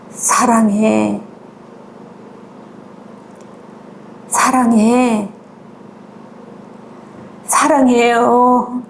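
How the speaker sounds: very slow, drawn-out words with long pauses; understated imitation of a sobbing man; retold tearful confession. Father tells mother he loves her, understated imitated tearfulness. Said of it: "이러면서 흐느끼는 거야".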